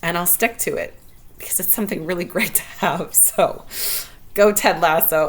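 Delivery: ordinary talk, nothing marked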